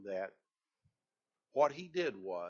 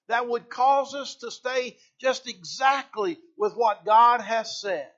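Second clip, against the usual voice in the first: louder, higher, emphatic sermon delivery; full-force preaching; 8 semitones higher, 11 dB louder than usual. Acted. Preacher driving home reverence for God, fervent. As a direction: preach it out to the congregation.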